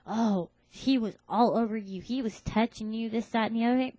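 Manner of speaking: put-on loud, jealous boyfriend nagging; jealous accusation. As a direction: accuse her loudly like a jealous guy.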